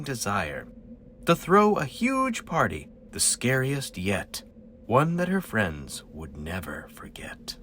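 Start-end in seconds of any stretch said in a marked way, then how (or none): 4.9-7.6 spooky near-whisper trailing low; hushed suspense. A narrator's reading. Narrator telling children of a witch's party, hushed suspense. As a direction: drop softly into an ominous hush.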